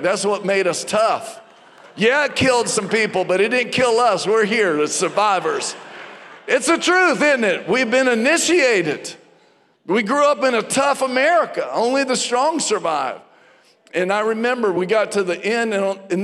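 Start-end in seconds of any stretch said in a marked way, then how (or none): 1.9-13.2 building louder and higher into a preacher's cry; rousing preaching. Acted. Preacher rallying the congregation, rising to a fervent call. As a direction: preach it harder line by line.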